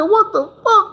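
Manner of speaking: like you can't believe your ears; shocked outburst. Retold or imitated. Hearing the friend drank his drink, stunned. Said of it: "I said".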